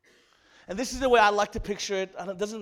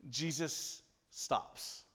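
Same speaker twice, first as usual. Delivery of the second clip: dramatic slow beat; 6 semitones lower, 11 dB quieter, much slower than usual. Real, not acted. Dramatic pause in story.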